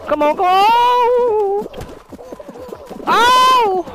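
very high, loud, drawn-out yells; desperate shouting. Real, not acted. Gamer begging his character to dive, desperate shouting. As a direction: yell in frantic desperation at the game.

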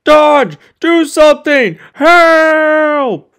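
loud high frantic yelling; high loud desperate shouts; panicked cries for help. Acted. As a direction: scream for rescue in panic. Gordon dangling, calls for help.

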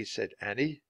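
ordinary delivery, nothing marked

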